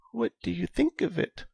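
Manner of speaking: put-on high, soft old lady voice; a frail elderly woman asking. Old Elsa voiced by narrator.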